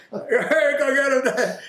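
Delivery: high, loud cheer broken by laughter; laughing cheer. Real, not acted. Cheering the father's comeback to Jack, laughing high and loud. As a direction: whoop it out through a laugh.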